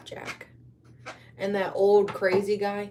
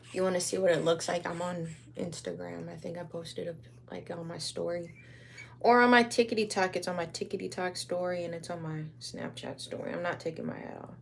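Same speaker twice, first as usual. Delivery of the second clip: ordinary talk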